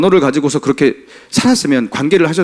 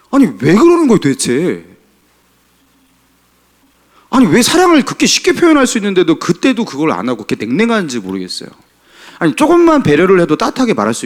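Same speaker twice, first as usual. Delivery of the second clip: frustrated outcry; 4 semitones higher than usual. Real, not acted. Preacher pleading with husbands about cold words, exasperated. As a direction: plead with the congregation in disbelief.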